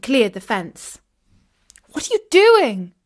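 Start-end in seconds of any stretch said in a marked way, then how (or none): 1.9-2.9 high, loud cry of dismay. A narrator's reading. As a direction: cry out in alarm, pitched high. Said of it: "cried out Katie in dismay".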